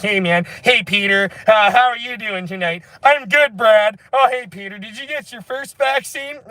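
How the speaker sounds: high, cheery caricature voice; mock sheepish diners. Mocking vaccinated friends chatting at dinner, high caricature voice. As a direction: greet friends like a gullible goody-goody.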